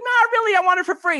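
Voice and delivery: high, loud coy refusal; Ephron haggling. Retold or imitated. Ephron haggling with Avram, high and coy. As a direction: play the sly bargainer up high.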